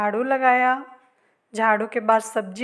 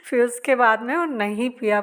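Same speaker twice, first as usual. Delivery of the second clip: ordinary talk, nothing marked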